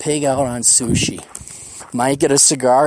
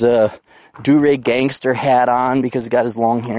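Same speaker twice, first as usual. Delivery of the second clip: ordinary delivery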